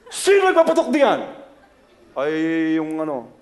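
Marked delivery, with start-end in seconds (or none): 0.1-1.2 much higher, loud angry shout; mock furious father; father's shout. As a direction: bellow at the noisy kids. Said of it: "Sabi ng tatay ko".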